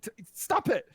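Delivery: stammering high yelp; whiny protest. Acted. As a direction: squeal like a slapped underling. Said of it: "as he's just like".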